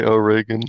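"Oregon" as oh-RAY-gun; mock mispronunciation. Shown as a demonstration. Teasing a friend's pronunciation of the state, mock mispronunciation. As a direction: deliberately botch "Oregon", stressed middle syllable RAY. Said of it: "might as well just say".

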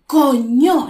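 loud COÑO with both syllables punched; force on each syllable. Shown as a demonstration. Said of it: "Le damos fuerza a cada sílaba".

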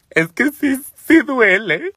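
high, tearful imitation of herself crying; mock crying. Admitting to the neighbour that it hurts, mock crying. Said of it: "No, hombre, hasta lloré".

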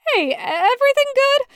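high, anxious stammer; nervous check. Acted. Alone in the tower, Taco asks nervously. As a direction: stammer it out, worried.

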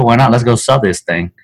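ordinary delivery

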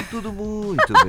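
slow laughing mock reply. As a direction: mimic it while giggling. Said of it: "Tudo mu-, tudo bem".

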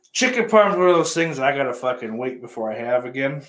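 usual speaking voice

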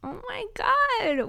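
long drawn-out high gasp of surprise; surprised. Real, not acted. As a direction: stretch it out, shocked and high. Surprised at own morning voice.

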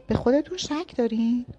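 seductive tone. A narrator's reading. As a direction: lure him in with a provocative dare. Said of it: "لحنم ... اغوا کننده بود".

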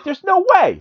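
high-pitched mock disbelief; put-on high cartoon voice; disbelieving Jimmy. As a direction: squeak it out as a flabbergasted sidekick. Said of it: "Jimmy's like".

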